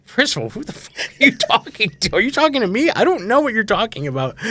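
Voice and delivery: high-pitched, affronted comeback; indignant stranger. Retold or imitated. Strangers answering a girl who yelled at them, indignant. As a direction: challenge them, voice shooting way up.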